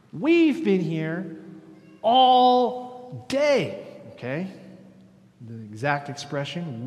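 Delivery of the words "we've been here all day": slow, high and loud, stretching We've been here all day; loud aggrieved protest. Retold or imitated. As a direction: hammer the complaint as if cheated. Same words, now loud protest.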